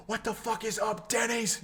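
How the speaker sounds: high, shouted "what the fuck is up"; viral video impression. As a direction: yell it up high like the Denny's video. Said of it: "You ever see that video?".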